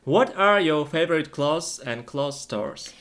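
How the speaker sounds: usual speaking voice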